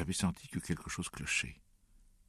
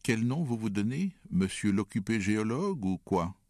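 higher and louder, teasing jab; mocking taunt; 6 semitones higher, 5 dB louder than usual. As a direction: needle him with a sarcastic question. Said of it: "Alors moi".

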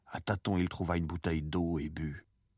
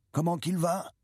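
fisherman calling up from below, higher pitch; raised, high-pitched call with a question; 11 semitones higher, 4 dB louder than usual. A narrator's reading. Fisherman asking the boy from down on the shore, called out. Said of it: "cria d'en bas l'un des hommes".

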